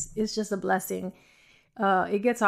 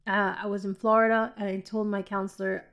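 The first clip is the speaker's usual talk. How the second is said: normal talking voice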